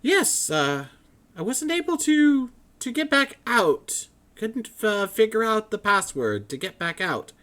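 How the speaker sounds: high, loud, flustered scholar voice; excited scholar. Acted. Matrius keeps talking.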